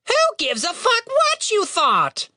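very high, slow squawking retort; rude raven. A narrator's reading. Raven cuts Tim off, squawking rudely. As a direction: cut in as an angry bird.

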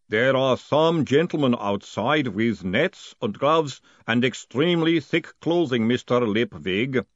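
slow, deliberate golem voice; dutiful golem. Acted. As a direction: speak as a patient golem, word by word.

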